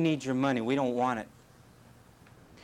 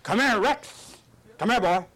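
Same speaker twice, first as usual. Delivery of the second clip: high, loud call to a dog; 6 semitones higher, 5 dB louder than usual. Retold or imitated. Guys calling their manly dogs, loud and high. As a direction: call out like a proud owner across the yard. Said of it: "they'd call their dog and say".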